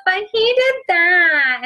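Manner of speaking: put-on high, drawn-out childish whine; mock whining child blaming a brother; whiny kid. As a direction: whine back like the other sibling. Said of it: "they gotta have the whine to it".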